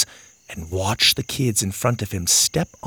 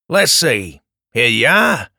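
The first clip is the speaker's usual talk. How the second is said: put-on a light Australian accent; friendly Aussie handover; 6 dB louder than usual. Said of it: "in an Australian accent".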